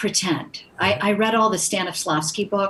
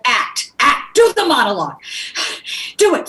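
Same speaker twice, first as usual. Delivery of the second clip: urgent, high raised-voice order; barked command; 5 semitones higher, 4 dB louder than usual. Retold or imitated. Brutal acting teacher to shocked student, commanding shout. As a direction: push the student hard to perform. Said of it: "And she said".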